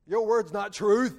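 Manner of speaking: loud, slow accusing line; mock defiance. Doubter accusing God, loud slow defiance. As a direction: belt out the unbelief. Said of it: "calling God a lie and says".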